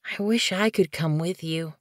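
soft, wistful little kitten voice; wistful sigh. Acted. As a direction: sigh it softly with longing.

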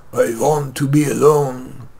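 slow, gravelly film star drawl; Greta Garbo voice. Retold or imitated. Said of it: "like Greta Garbo's gravelly".

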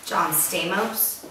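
"John Stamos" said slowly as STAY-mohs; soft, slow corrective "John Stamos"; correcting pronunciation. Acted. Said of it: "Is that how you say it?".

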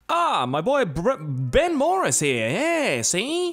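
put-on drifting old gangster accent that slips toward British by the end. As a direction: play a wisecracking 1920s mobster barber in a gangster accent. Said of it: "That accent's completely changing".

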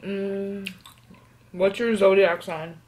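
talking through a mouthful; words muffled by food; mouth full. Real, not acted. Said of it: "Eating with my mouth full".

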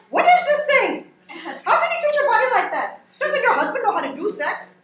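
high, loud mock outrage; pissed-off mother. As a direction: scold loudly as an imitated furious mother. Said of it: "she's pissed".

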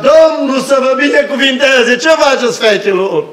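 calling out high and loud down the hallway; mother calling out cheerfully down the hospital hall; shrill calling out. Retold or imitated. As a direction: hail the nurses loud and high. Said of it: "s-auzea o voce stridentă, care striga".

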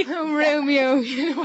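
mock Kerry Romeo, loud; Kerry accent. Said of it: "a nice Kerry accent".

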